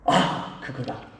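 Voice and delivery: breathy, sudden realization; whispered realization. Retold or imitated. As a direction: let it dawn on you in a whisper. (깨달음의 속삭임)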